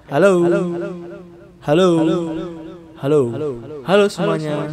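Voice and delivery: echoing like a call to prayer; mosque echo. Shown as a demonstration. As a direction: halo ringing with echo. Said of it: "kayak suara azan".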